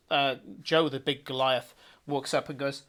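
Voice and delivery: ordinary talk, nothing marked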